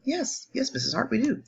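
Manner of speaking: high sing-song student reply; eager student voice. Pretend. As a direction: answer like an eager kid. Teacher answers her own question.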